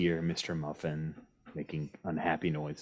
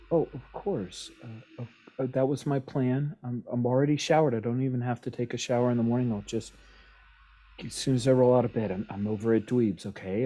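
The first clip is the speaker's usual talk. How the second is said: fast, higher, tumbling over words; nervous babbling; 5 semitones higher, 4 dB louder, faster than usual. Acted. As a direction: rush the excuses to calm her down.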